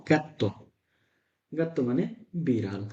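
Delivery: ordinary delivery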